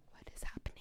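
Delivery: breathless whispered disbelief; stunned whisper. Retold or imitated. To the listeners about the stranger's faith, a stunned whisper. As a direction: whisper it like you can't believe your ears.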